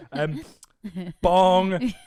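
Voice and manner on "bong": louder booming bong; mock news bong. Presenter introducing the next story with a loud mock bong. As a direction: chime it like Big Ben.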